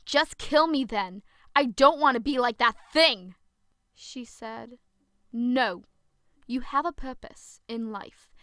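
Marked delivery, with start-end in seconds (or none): 0.0-3.4 loud, anguished shout; despairing yell. Acted. Zoe refusing to become a monster, loud yell. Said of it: "I yelled". 5.3-8.3 firm no. Acted. Protector firmly refuses Zoe.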